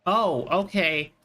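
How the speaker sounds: ordinary talk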